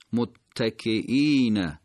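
متکئین slowly as "mut-ta-ki-īīna"; deliberate recited متکئین, falling ending; doubled t, long vowel. Shown as a demonstration. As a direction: hold the tt and stretch ī in متکئین. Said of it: "تشدید حرف تا دقت بفرمایید".

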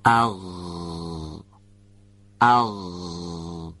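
اغ with a voiced, rasping throat fricative held long; اغ with a voiced gargle at the back of the throat; ghayn demo. Shown as a demonstration. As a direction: let the air scrape through the back of the throat on اغ, like gargling.

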